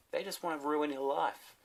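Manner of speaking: high, sing-song sarcastic glee; mock cheerful. Griping to viewers about a new TV that won't work, mock cheerful. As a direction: jeer in a high voice, bursting into a whoop. Mocking the makers' happy promise.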